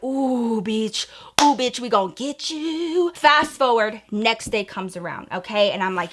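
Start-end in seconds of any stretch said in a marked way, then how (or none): 0.0-3.1 gloating taunt. Retold or imitated. Gloating after taking back the keys.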